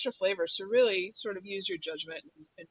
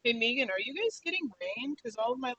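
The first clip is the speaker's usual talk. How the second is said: normal talking voice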